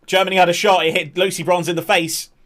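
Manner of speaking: quick excited call. Acted. Commentator reacting to a German shot, excited. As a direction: snap to the sudden shot.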